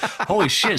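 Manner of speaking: hammed-up disbelief; mock shock. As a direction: fake being floored. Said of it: "So it's like".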